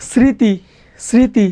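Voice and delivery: স্মৃতি said as sriti, the m dropped; silent m pronunciation. Shown as a demonstration. Teacher gives students an example of silent mofola, স্মৃতি as sriti. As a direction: pronounce স্মৃতি carefully without any m sound. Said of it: "মফলা থাকে তার উচ্চারণ হয় না".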